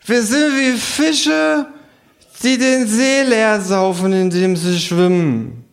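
high, slow kangaroo voice; drunk kangaroo. Acted. Kangaroo philosophizing at the bar, high slow drawl. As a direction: stretch out the kangaroo's drunken wisdom.

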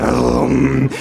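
short revving engine imitation; mouthed engine noise; lawnmower roar. A narrator's reading. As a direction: growl out a motor rev.

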